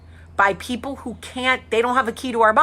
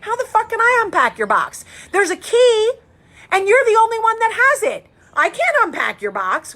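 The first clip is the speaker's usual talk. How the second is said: exasperated family voice; 9 semitones higher than usual. Retold or imitated. Family answering Jamie, pitched higher and exasperated. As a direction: throw it back at her, exasperated. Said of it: "And you know what they said?".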